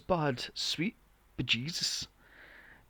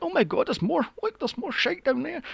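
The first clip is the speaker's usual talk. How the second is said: much higher, excited squeal of discovery; mock shocked discovery; 12 semitones higher than usual. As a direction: gasp like you found more filth under the rock. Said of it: "picked up one of the rocks and went".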